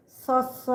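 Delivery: স stretched slowly in the Purulia way; drawn-out s. Shown as a demonstration. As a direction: lean on the স, hold it longer. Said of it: "সটা একটু বেশি করে বলতে হবে". (পুরুলিয়ার ঢঙে টেনে বলা স)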